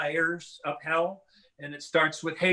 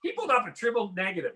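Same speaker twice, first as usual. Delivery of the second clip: ordinary talk, nothing marked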